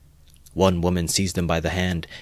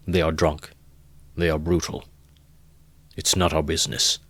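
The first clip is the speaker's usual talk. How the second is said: weary father. A narrator's reading. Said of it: "said his father".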